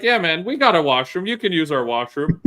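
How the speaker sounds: high, friendly clerk voice; imitating gas station guy. Clerk offering his private washroom, high eager voice. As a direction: offer the washroom like a happy host.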